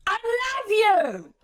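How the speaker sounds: exaggerated angry take; angry, blunt I love you. Shown as a demonstration. Speaker trainer demonstrating the same sentence, exaggerated angry. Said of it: "anger, frustration, blunt directness".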